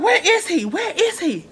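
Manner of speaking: high mock pestering. Olivia pestering her mom.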